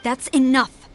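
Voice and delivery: loud cutting reprimand; stern rebuke. Acted. Commander silencing her officers' excuses. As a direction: shut the excuses down.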